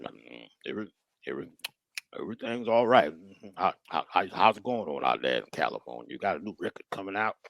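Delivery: put-on deep, mumbling old man voice; drowsy uncle after brown liquor; tipsy uncle. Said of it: "that brown liquor".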